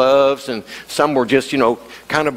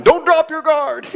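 much higher, slow, yelled warning; coach yell; 12 semitones higher, slower than usual. Retold or imitated. Boxing coach yelling at the young fighter from the sideline. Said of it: "the coach yelling from the sideline".

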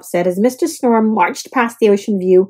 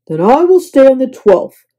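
put-on grumpy old man; 3 semitones higher, 6 dB louder than usual. Mister Snore.

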